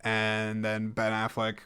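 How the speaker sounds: ordinary talk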